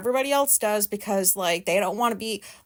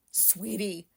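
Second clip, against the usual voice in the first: bitter mutter; 4 semitones lower, 11 dB quieter than usual. Real, not acted. Bitter repeat of the word.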